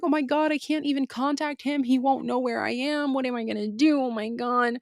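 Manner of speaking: high-pitched mock wail; retold tearful panic. Stranded sister unable to contact her brother, high mock-panicked wail. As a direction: whine it high, imitating a helpless meltdown. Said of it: "I start crying".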